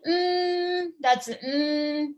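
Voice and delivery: "mm" hummed high and level; first tone. Shown as a demonstration. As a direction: hold "mm" on one high, unbending note. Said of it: "That's first tone".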